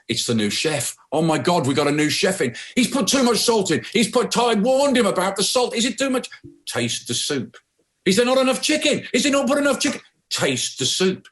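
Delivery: a waiter spiralling into panic about the salt; panicking waiter. Retold or imitated. Waiter panics over the soup.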